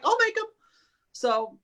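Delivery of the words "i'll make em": eager self-quote. Retold or imitated. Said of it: "I'm like".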